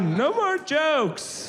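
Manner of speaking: high, drawn-out mock yell; exasperated boss's outburst. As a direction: play the boss loudly banning all fun. Boss bans jokes, acted outburst.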